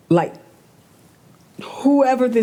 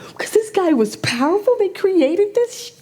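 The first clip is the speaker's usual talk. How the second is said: upset; 12 semitones higher, 3 dB louder than usual. Real, not acted. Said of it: "Clearly, I'm upset".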